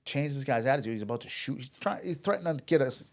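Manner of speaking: normal talking voice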